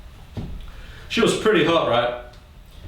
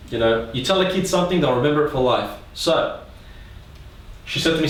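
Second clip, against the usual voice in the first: ordinary talk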